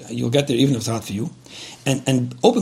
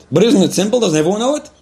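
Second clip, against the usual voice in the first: higher, louder incredulous question; mock disbelief; 6 semitones higher, 4 dB louder than usual. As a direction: ask as if it's obvious. Voicing listener's disbelief.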